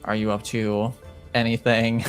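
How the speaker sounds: ordinary talk, nothing marked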